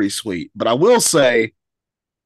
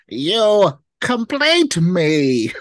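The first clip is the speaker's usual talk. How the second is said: dramatic movie quote; 7 semitones higher, 4 dB louder, much slower than usual. Pretend. Quoting a romantic movie line.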